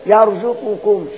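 يرزقكم recited slowly, qaf assimilated into the kaf; merged qaf into kaf. Shown as a demonstration. Teacher giving an example of idgham, يرزقكم with qaf merged. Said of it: "تقلب القاف كاف".

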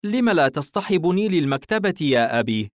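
put-on high-pitched boy making a request; young son. Son asking his father for a library trip, high boy voice. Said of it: "أمسك الصغير كتابا".

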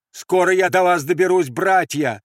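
loud, high call. Acted. Andres announcing he is nearly through, calling out. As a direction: hail them as brothers. Calls up to the guards.

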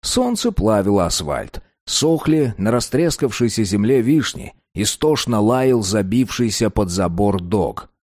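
plain reading voice

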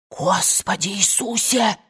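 slow, drawn-out gasped exclamation; scandalized, hushed amazement; shocked gasp. A narrator's reading. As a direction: gasp it in astonishment, stretching the words. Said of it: "ахнула мамушка".

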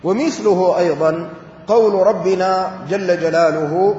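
normal talking voice

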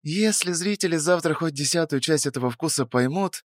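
put-on high, soft, dreamy young woman; dreamy drawl. A narrator's reading. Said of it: "мечтательно протянула она".

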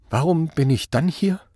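high, tearful, trembling question. A narrator's reading. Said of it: "fragte er mit zitternder Stimme". (mit zitternder Stimme)

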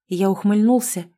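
plain reading voice

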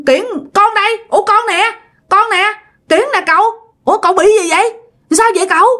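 high-pitched, puzzled reassurance of identity; acted nephew's worried questioning; bewildered insistence. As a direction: insist it's really you, baffled.

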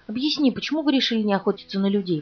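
plain reading voice

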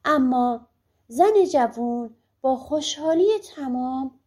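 ordinary delivery, nothing marked

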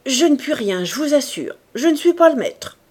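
a powerful man loudly disclaiming any power; exclaiming. A narrator's reading. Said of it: "il s'écria".

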